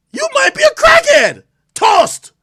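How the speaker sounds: high, loud mock shout; shouted verdict. Playing judge, he yells his ruling at the accuser. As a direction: yell the insult and slam the ruling.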